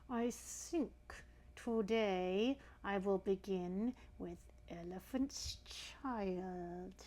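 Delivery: very slow crocodile with clamped teeth; between the teeth. A narrator's reading. Crocodile about to eat the elephant's child, speaking between its teeth. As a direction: draw it out through closed teeth. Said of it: "said it between his teeth like this".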